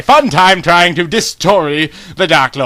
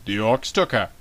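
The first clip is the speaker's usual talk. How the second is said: hushed guard; 7 semitones lower, 10 dB quieter than usual. Acted. Guard reports the kidnapping.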